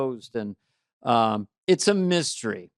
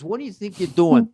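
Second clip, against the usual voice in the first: pitched up, pushier tough-guy challenge; mock Goomba guard; 6 semitones higher than usual. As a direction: lean on it like a hotel heavy. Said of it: "He says".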